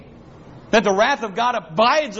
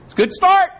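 emphatic outburst; 12 semitones higher, 6 dB louder than usual. Real, not acted. Preacher peaks in volume.

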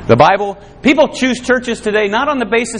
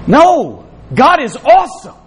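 emphatic exclamation; 5 semitones higher, 5 dB louder, slower than usual. Real, not acted. Preacher's emphatic rebuttal.

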